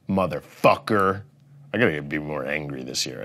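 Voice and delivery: ordinary delivery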